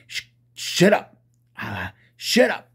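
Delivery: mocking shut up. Imitating people who silence Christians, high mock scolding. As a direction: sneer out a rude silencing.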